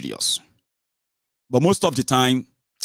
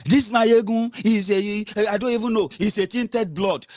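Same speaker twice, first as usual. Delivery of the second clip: mocking his critics; 10 semitones higher, 3 dB louder than usual. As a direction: mimic indignant name-calling. Mimicking his offended critics' insults.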